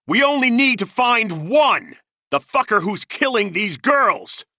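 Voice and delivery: furious high shout; shouting back. A narrator's reading. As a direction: yell it back, full force. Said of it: "Bean shouted back".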